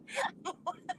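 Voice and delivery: breathless, high giggling; real wheezy cracking-up; helpless laugh. Real, not acted. Laughing at own story.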